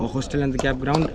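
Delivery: usual speaking voice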